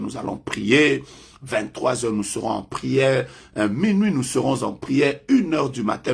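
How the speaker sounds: usual speaking voice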